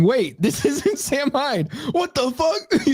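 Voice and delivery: high, drawn-out mock dismay; put-on high voice of disbelief. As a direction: imitate a viewer's dawning shock, half laughing.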